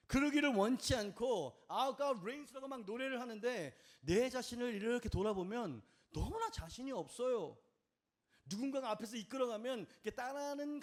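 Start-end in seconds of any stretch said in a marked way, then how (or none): none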